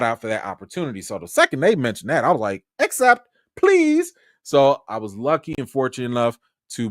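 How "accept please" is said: high, loud excited plea; eager plea. Retold or imitated. Vlogger recalling his thrilled reply to the invite, high and eager. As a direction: beg to accept, thrilled.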